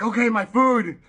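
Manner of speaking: loud, high, excited burst; acted hungry excitement; eager for food. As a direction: exclaim it like food has finally arrived.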